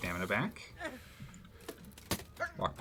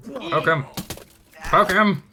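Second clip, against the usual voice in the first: raised, louder repeated command; urgent combat yell; 6 semitones higher, 6 dB louder, slower than usual. Pretend. Player urges a jab at the enemy, urgent yell. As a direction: egg on the attack, building louder.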